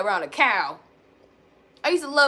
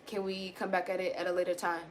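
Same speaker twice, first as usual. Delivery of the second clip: much softer, gentle ask; softer voice asking to come back later; soft calm request; 4 semitones lower, 9 dB quieter than usual. Shown as a demonstration. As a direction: quietly ask to talk later. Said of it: "I didn't say".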